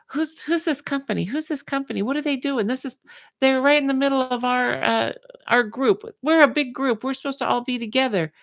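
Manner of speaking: high, shrill-ish mock outrage; indignant moms. Workout moms going to town.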